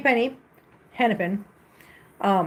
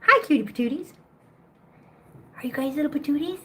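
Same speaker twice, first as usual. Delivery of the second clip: cooing pet voice; 6 semitones higher than usual. Real, not acted. Owner greeting her cats in high cooing baby talk. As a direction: coo at the kittens like a doting owner.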